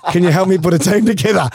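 laughing while quoting; laughing through it. Retold or imitated. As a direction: quote broken up by laughter. Retelling the manager's request.

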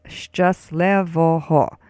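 "szczęśliwego" drawn out as shchus-leh-vo-ho; slow word demo. Shown as a demonstration. Teacher puts it together, "szczęśliwego" slow as shchus-leh-vo-ho. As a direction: sound out "szczęśliwego" slowly, every syllable clear. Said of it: "let's put it all together now".

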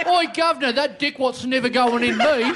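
Cockney chimney sweep voice; loud, laughing Cockney street urchin. Retold or imitated. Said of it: "she sounded like a chimney sweep".

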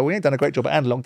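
rattled off fast and dismissive; fast scornful aside; quick dismissive quote. Retold or imitated. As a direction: toss it off quickly, unimpressed. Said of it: "I was the first one to go".

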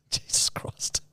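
breathless whispered disbelief; shocked whisper. Real, not acted. Stunned whisper on hearing the slang. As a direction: whisper it, slowly, aghast.

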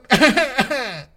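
high cackle, loud and muffled; high gleeful laugh. A narrator's reading. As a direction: laugh triumphantly underwater. Figure gloats inside the glass.

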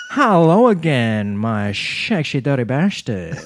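slow, hammed-up Dutch accent with slushy S sounds. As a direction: imitate a Dutch vlogger's drawn-out greeting. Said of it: "with this very beautiful Dutch accent".

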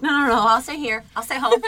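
high, loud mock protest; playful refusal. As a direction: turn the offer down brightly and loudly, half laughing. Acts out refusing to return to office.

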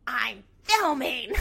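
loud, slow, annoyed snap of the words; pissed snap. Retold or imitated. Kale, annoyed at friend's teasing, snapping loud and slow. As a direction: scrunch up your face and bite it out, loud and slow. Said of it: "scrunches up her face".

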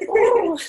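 high, loud imitated dove coo. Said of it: "like you're like a dove".

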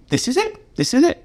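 high, breathless self-talk; thinking this is the moment, high; excited inner voice. Retold or imitated. Writer thinking the deal is done, excited and high.

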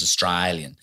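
"Australian" as "Austr-eye-lian"; "Australian" with a long I in the middle. Shown as a demonstration. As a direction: turn the A in "Australian" into an eye sound. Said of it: "He says A is an I, so he says".

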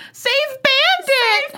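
high, slow mock cry of save Bandit; quoting The Office. Quoting the sitcom scene to her cohost, high mock wail. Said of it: "That whole".

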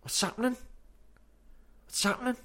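high, casual Scouse "What's happening?"; Scouse greeting. Shown as a demonstration. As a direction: toss off "What's happening?" Liverpool style. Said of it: "Means, How are you?".

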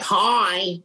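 high-pitched drawn-out greeting, in imitation; Fran Drescher. Medium showing how a woman spirit sounded, Fran Drescher impression. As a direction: put on a high comic celebrity voice. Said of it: "kinda like Fran Drescher".

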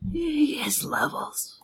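dramatic whisper. Acted. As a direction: breathe it out mysteriously. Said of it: "whispered dramatic aside".

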